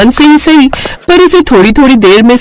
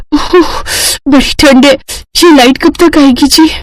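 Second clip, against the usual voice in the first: put-on higher, chilled young wife; shivering with cold; 5 semitones higher than usual. Freezing wife asks husband about power, shivering. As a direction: complain as if freezing.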